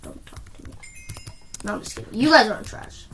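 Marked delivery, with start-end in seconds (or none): 0.0-0.7 whispered taunt. Acted. Mock insult, then denies.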